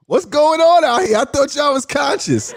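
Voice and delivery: high-pitched, exasperated outburst; amused disbelief. Real, not acted. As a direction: cry out in laughing disbelief. Mock outrage at audience, laughing.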